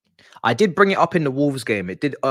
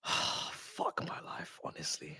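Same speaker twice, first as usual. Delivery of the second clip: slow, breathless whisper of defeat; despairing whisper; 8 semitones lower, 16 dB quieter, much slower than usual. Real, not acted. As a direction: let it out under the breath. Despairing at the match.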